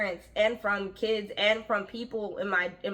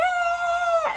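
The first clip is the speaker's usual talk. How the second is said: mock shriek. Reacting to the gushing greeting.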